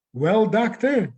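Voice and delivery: put-on a patient's German accent, drawn out. Patient addressing his psychiatrist in a German accent. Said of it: "he spoke with a German accent".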